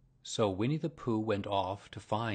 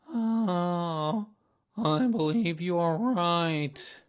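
high, loud, drawn-out lament; long sad sigh; 8 semitones higher, 7 dB louder, much slower than usual. A narrator's reading. Donkey admitting the bear is right, sighing sadly. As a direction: sigh it out very slowly, woefully. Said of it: "with a long, sad sigh".

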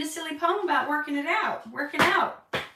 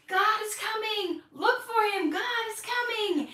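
proclaiming call; 10 semitones higher than usual. Retold or imitated. John the Baptist warns the people, a high proclaiming cry. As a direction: call it out like a desert preacher. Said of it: "he's proclaiming".

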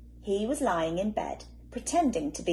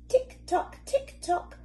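clipped, breathy clock ticks; whispered tick-tock; 10 semitones higher, slower than usual. A narrator's reading. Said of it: "went the living room clock".

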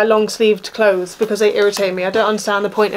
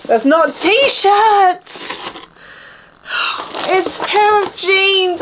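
high, slow, crushed disbelief; dismayed disbelief; 8 semitones higher, much slower than usual. Real, not acted. Opening a clothes box, dismayed at jeans, slow and high. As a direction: let the letdown stretch out slowly.

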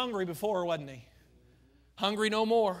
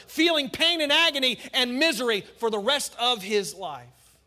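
preaching at full force; 6 semitones higher, 8 dB louder than usual. Acted. Preacher builds the torment image.

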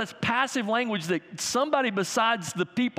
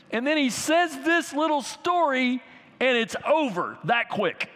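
raised-pitch, animated preacher; emphatic preaching; 6 semitones higher than usual. Real, not acted. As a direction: drive the point home to the congregation. Preacher builds emphasis on the story.

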